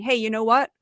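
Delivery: ordinary delivery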